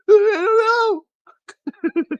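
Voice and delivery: high, loud mock wail; hammed-up tearful teen; mock sobbing. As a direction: whimper it like a crying boy. Teen self sobbing in bathroom.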